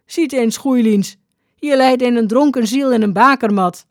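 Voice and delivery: high, loud call to the others. A narrator's reading. Workmen to each other on finding a drunk, calling out loudly. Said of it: "malkande toeriepen".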